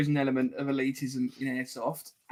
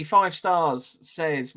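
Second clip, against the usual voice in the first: usual speaking voice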